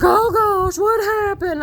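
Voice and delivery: imitated alarm. Uncle's alarmed question, acted.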